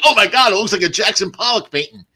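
high-pitched acted astonishment; mock amazement. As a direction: exclaim it as a comic shocked reaction.

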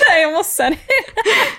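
laughing. Real, not acted. Giggling during the word game.

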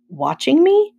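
high, loud rising echo question; startled disbelief. A narrator's reading. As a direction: throw it back, pitch shooting up. Boy echoes friend's words in disbelief.

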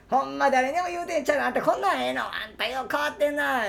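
high brash Osaka auntie. Retold or imitated. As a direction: needle him like a pushy auntie. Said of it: "みたいな感じで言われて".

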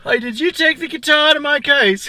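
high, loud British bloke voice, laughing; yelling across the room in a British accent. Acted. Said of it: "We sound more like".

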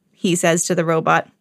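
plain reading voice

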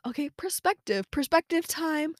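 high-pitched, mock-chirpy announcement; self pep talk. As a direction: announce it like a little treat, drawing it out. Announces perspective time to herself.

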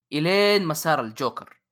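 normal talking voice

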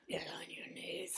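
high, breathless mock whispered command. Daughter voices her mum's mock demand, whispered high. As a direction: hiss it like a melodramatic mother.

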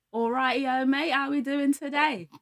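hammed-up British greeting that goes Aussie; British accent slipping Australian. Shown as a demonstration. Friend showing her airport accent, a hammed-up British accent. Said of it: "Went a little bit Australian".

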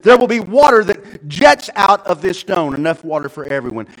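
loud, high-pitched divine instructions; booming proclamation. Acted. God telling Moses to strike the rock, proclaiming. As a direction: proclaim each order with force. Voices God's orders to Moses.

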